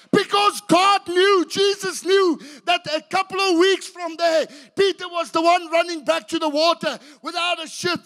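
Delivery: loud, high, urgent preaching; preaching at full force. Acted. Peter running back to the water, loud preaching. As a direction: drive it home to the room.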